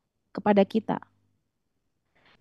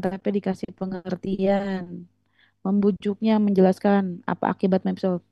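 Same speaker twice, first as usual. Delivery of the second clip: ordinary talk, nothing marked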